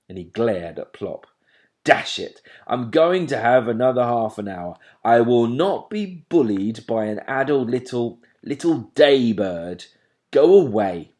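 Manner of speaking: loud scolding from a sleepy dad; loud fed-up father owl; cross father owl. A narrator's reading. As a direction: tell off the pesky owlet loudly.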